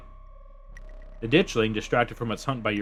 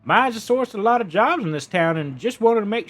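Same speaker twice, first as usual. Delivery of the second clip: higher, louder character voice; a made-up voice for the supervisor; put-on voice for him; 10 semitones higher, 4 dB louder than usual. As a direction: play the mine boss's man smoothly. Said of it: "what's my voice for him".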